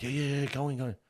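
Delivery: urging him on. Retold or imitated. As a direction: eagerly push him forward, like onlookers. Retold bystanders urging son on.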